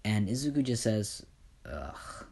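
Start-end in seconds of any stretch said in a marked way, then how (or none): 1.7-2.2 low, soft grunt of annoyance; annoyed groan. Acted. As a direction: groan quietly, fed up. Izuku annoyed at the delay.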